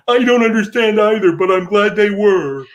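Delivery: mocking high-voiced impression; mock Jack voice. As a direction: answer in a high, silly caricature voice. Acted reply in Jack's mock voice.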